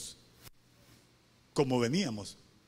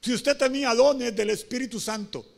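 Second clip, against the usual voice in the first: raised, high-pitched sermon delivery; impassioned preaching; 7 semitones higher, 6 dB louder than usual. Acted. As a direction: preach with mounting urgency. Preaches at full force.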